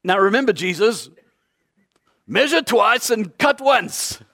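a hint of a proud dad giving carpentry advice; instructing father. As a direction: play the dad teaching his son the trade. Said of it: "Giving the Creator tips on carpentry".

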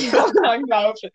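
laughing while saying langlopen. Real, not acted. Laughs at the word.

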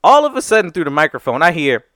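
ordinary talk

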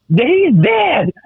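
high-pitched mock commentator's horror; Fowler. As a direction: cry out like an aghast wrestling announcer, hammed up. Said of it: "was it Fowler going".

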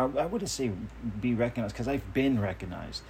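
ordinary talk

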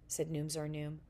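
usual speaking voice

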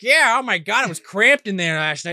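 high, loud leprechaun chatter; squeaky excited leprechaun. Retold or imitated. Leprechauns voiced.

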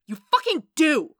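high, furious shout. A narrator's reading. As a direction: yell it in outright exasperation. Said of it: "Foggy shouted".

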